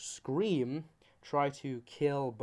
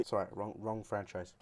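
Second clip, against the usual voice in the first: usual speaking voice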